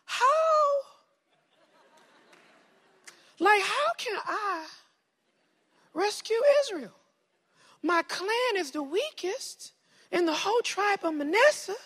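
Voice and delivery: put-on very high southern-accented whine of doubt. Gideon doubting he can rescue Israel, high southern accent. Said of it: "I gave him a southern accent".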